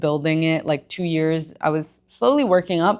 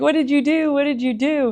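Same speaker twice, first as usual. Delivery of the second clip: higher, eager imitated questions; classmates asking eagerly; 9 semitones higher than usual. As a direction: mimic curious classmates, pitched up. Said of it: "People in my school were asking me".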